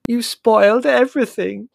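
high, loud, furious wail; angry. A narrator's reading. Margot blaming Prue for ruining the séance, angry. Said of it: "said Margot angrily".

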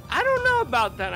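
lightly voiced dubious mom; imitated doubtful mom. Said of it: "She goes".

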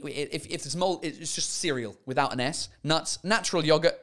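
ordinary talk

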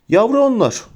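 sorrowful. A narrator's reading. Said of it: "dedi hüzünlü bir ses yavaşça". (hafif hüzünlü)

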